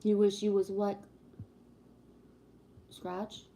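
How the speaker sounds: normal talking voice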